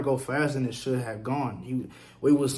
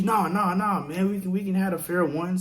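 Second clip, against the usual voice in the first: higher, hurried mock plea; mock backing down; 6 semitones higher than usual. Knife guy backing off as he reaches, higher mock-panicked pleading. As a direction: imitate the knife guy suddenly losing his nerve.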